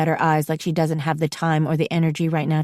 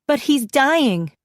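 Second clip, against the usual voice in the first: slow, higher blurt; 8 semitones higher, slower than usual. A narrator's reading. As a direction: let it slip out, high and drawn out. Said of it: "I blurt out".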